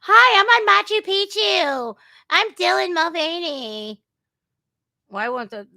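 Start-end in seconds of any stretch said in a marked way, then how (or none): 0.0-4.0 high, loud mock girly voice; mocking Dylan Mulvaney. Mocking the influencer's headline quote, high and loud. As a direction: lampoon the influencer in a high squeal. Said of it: "they sound like they have the most saying".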